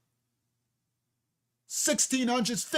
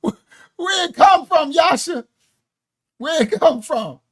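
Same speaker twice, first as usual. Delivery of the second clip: slow, loud, high Jamaican patois attempt; mock Jamaican patois; 8 semitones higher, 7 dB louder than usual. Asking Yasha where it comes from in mock Jamaican patois. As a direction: stretch the patois out, calling Yasha. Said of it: "I know I'm slaughtering it".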